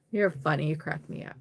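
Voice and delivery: normal talking voice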